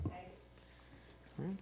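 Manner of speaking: ordinary delivery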